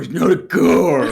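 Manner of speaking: slow, loud guttural scream; death metal shout. Acted. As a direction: roar it like a metal frontman. Death metal growl joke.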